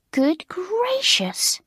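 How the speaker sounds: drawn out in disbelief; astounded. A narrator's reading. Alicia finding the hairpins in the chimney, astounded. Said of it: "in an astounded voice".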